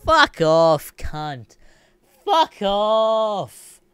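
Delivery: loud then high, stretched fuck off; angry dismissal. Real, not acted. Dismissing Apple, an angry high fuck off. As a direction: tell them where to go.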